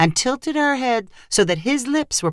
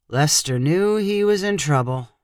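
usual speaking voice

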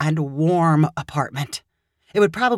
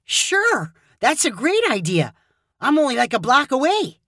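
higher, quick eager agreement; eager reply; 7 semitones higher than usual. Acted. Caleb eagerly agreeing to take Hannah home. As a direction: jump at the idea.

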